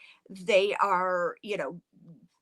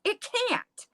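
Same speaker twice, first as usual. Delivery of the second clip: flabbergasted; 3 semitones higher than usual. Retold or imitated. Father cannot believe it's answering the children, flabbergasted. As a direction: protest in baffled disbelief. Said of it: "he is just flabbergasted".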